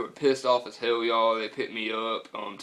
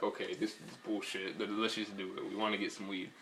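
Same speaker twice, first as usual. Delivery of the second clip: quiet grumble; 2 semitones lower, 10 dB quieter than usual. Retold or imitated. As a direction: mutter it quietly to your friends. Retelling grumbling to his friends.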